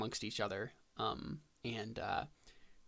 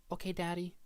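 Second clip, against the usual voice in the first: put-on high, small child's voice; little girl voice; 8 semitones higher than usual. Player voicing a four-year-old answering her daddy, high and childlike. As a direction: answer like an obedient little kid.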